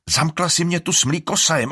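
loud, high angry yell; shouting. A narrator's reading. Alrik shouting at Iris he was left with the monster. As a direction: yell it in accusation. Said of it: "křičí Alrik".

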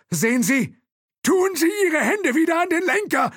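very high, loud command. A narrator's reading. Thompson orders the driver, very high and loud. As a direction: order him sharply. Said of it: "befahl Thompson".

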